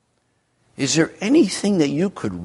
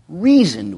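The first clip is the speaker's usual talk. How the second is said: REASONED pushed out louder; stress on REASONED; 4 semitones higher, 5 dB louder than usual. Shown as a demonstration. Preacher recalling his reasoning with Felix, stress on REASONED. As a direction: lean hard on the word "reasoned". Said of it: "I didn't emphasize that word".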